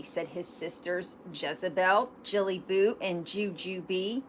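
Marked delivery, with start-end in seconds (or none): none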